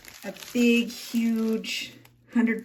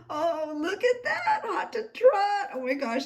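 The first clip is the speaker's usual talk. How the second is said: gushing delight; 11 semitones higher than usual. Real, not acted. Amused delight at gift picture.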